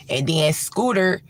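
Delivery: ordinary delivery, nothing marked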